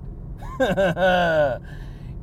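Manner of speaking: a long, gleeful cackling laugh; big laugh. Real, not acted. Laughs at his own line.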